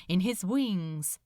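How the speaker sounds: plain reading voice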